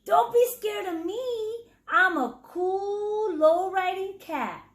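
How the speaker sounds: high, coaxing cat voice; smooth-talk the scared mice. Acted. Cat soothing frightened mice, high and coaxing. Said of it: "Chato purred".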